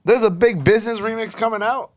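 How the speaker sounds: high, loud surprised question. Real, not acted. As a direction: repeat it in sudden disbelief.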